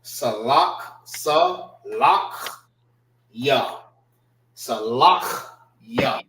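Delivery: Salakya in separate slow pieces, "sa, lakh, ya"; proper pronunciation. Shown as a demonstration. Teacher modelling the word, slowly syllabled Salakya. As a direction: break up Salakya with a rasped kh before "ya". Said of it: "That's how you pr-, properly pronounce it".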